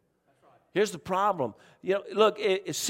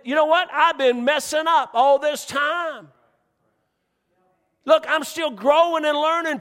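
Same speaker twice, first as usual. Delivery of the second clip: louder, higher preacher's build to a shout; preaching at full force; 7 semitones higher, 3 dB louder than usual. Acted. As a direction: drive it home to the congregation.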